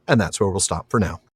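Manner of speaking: usual speaking voice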